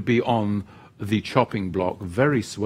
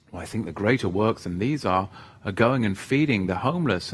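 ordinary talk, nothing marked

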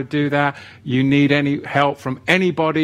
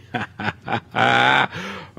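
laugh; 4 semitones lower than usual. Real, not acted. Laughs at hashtag pun.

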